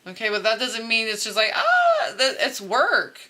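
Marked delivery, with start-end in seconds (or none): 1.5-2.1 high drawn-out "ah"; playful falsetto ahh; mock blissful sigh. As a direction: sigh high and airy, mimicking easy bliss. Mimics dreamy ease of love.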